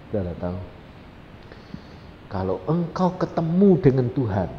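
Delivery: ordinary delivery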